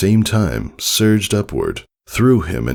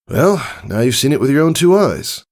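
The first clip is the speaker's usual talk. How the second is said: higher, quick, casual stallion voice; breezy, a bit boastful; friendly older stallion; 10 semitones higher than usual. Acted. Tycho telling Scootaloo about learning it, casually.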